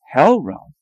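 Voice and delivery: loud, high ironic exclaim; dramatic question. Acted. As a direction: proclaim it theatrically.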